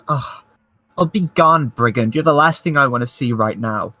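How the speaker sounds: eye-rolling exasperation. Acted. Woman in the tree dismissing a supposed brigand, exasperated. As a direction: shoo them away with weary annoyance. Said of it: "roll her eyes and say".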